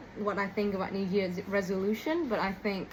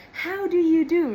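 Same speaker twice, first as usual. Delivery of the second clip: "How do you do?" said much higher and louder, falling on "do"; old Hollywood greeting; 9 semitones higher, 9 dB louder than usual. Retold or imitated. Recalling her formal greeting to Nick, high and loud old Hollywood style. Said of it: "like, old Hollywood movies".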